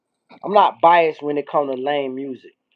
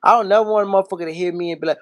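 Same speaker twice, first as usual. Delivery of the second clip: ordinary delivery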